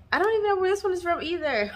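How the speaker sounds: high, quick words through giggles; laughing. Real, not acted. Vlogger laughing at her own forgetfulness. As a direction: laugh it off, embarrassed.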